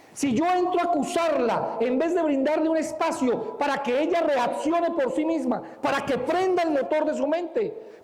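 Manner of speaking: más alto, insistiendo en cada frase; predicación enfática. Acted. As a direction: advierte a la congregación con fuerza. Predica con fuerza. (el predicador advierte con fuerza a la congregación)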